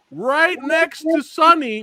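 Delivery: ordinary talk, nothing marked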